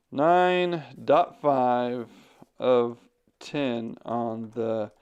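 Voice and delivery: very slow, drawn-out words; typing aloud. Real, not acted. Streamer types a rating in chat, slow dictation. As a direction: say each word slowly as you type it.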